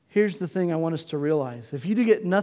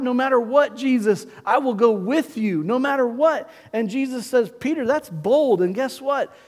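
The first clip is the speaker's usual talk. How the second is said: higher, louder fervent sermon storytelling; preaching at full force; 6 semitones higher, 5 dB louder than usual. Acted. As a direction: drive the story home to the congregation.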